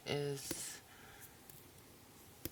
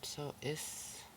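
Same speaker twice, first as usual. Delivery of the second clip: whisper; 3 dB quieter than usual. Shown as a demonstration. Whispered lead-in to the word.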